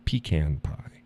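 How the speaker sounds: usual speaking voice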